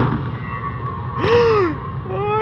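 long high gasp of fright; frightened gasp. Real, not acted. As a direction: gasp in terror. Monster appears.

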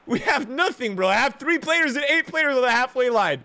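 high, agitated complaint; frustrated rant. Real, not acted. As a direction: rant in exasperation at your tactics. Ranting about own tactics.

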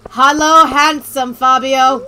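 loud, slow hammed-up flirting; mock seductive. Streamer on Operation Seduce, flirting with a game character in a hammed-up way. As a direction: drag out the pickup line like a cheesy seducer. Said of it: "It is time for Operation Seduce".

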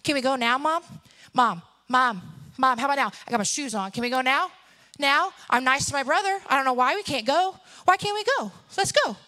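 imitating an impatient child nagging; pestering five-year-old. Five-year-old nagging mom to leave, pestering. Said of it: "like my little five-year-old who will come into me and ask me the same question ten times".

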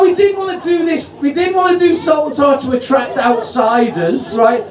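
high-pitched projected compere call; rally the whole room, pitch held up; real crowd work, calling out to get some noise. Real, not acted.

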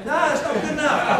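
ordinary talk

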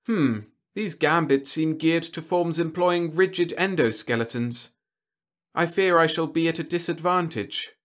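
doubtful alien warlord. A narrator's reading. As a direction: voice a genteel alien's misgivings.

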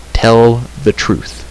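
slow, deliberate command; stern demand. A narrator's reading. Terran confronting the creature that deserted, stern. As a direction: space the words out firmly.

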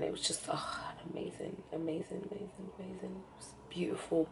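fading, breathless whisper of amazing; awed whisper. Real, not acted. To viewers recalling an emotional day, awed whisper. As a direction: let it trail into a hushed whisper, overcome.

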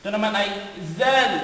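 usual speaking voice

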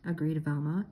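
ordinary delivery

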